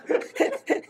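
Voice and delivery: a bright, high burst of laughter; real laugh. Real, not acted. Laugh after recalling visit.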